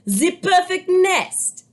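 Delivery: put-on high, slow French-accented duck. A narrator's reading. Duck admires the nest, high French accent. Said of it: "she cried".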